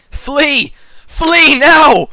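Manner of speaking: loud, high hammed-up warning shout; mock alarm. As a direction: cry out in pretend panic. Acts panicked words describing French.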